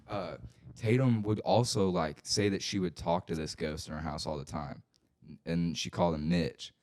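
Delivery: usual speaking voice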